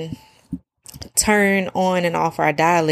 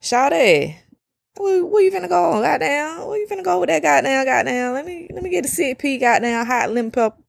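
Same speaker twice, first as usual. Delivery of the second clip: high, loose, fast street talk; homie slang voice; 8 semitones higher than usual. Retold or imitated. Talking to friends around us, high fast slang. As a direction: slip into relaxed slang with the homies. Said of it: "But when I'm around us".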